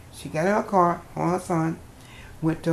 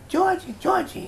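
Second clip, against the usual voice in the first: calling out; 14 semitones higher, 5 dB louder than usual. Retold or imitated. Father bursts in calling.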